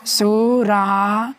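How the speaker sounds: असुरालय stretched slowly with a rising end; slow sounding out. Shown as a demonstration. Teacher spelling a word aloud to students, slow and rising. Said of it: "teacher sounding out the word".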